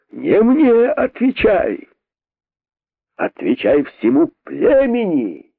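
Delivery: high slow commanding cry; accusing shout. A narrator's reading. King demanding the rabbit answer the tribe, shouting. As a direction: order him to face the tribe. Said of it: "закричал король".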